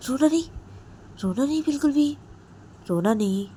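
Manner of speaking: high, loud stern order; harsh command. Retold or imitated. Jiya ordering Ritu not to cry, harsh. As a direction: warn her sharply not to cry. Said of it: "तो जिया बोलती है".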